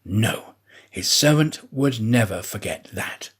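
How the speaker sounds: ordinary delivery, nothing marked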